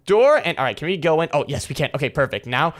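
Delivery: ordinary talk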